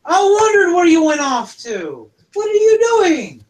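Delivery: high, loud cry of relief; overjoyed kobold crying out; tearful reunion. Acted. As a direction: near tears, calling out to her. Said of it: "And he goes".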